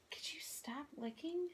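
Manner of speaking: hushed whisper, no voice; whispering to the dog. Real, not acted. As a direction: whisper a scolding. Scolding the dog mid-video.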